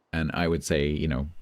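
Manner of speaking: ordinary talk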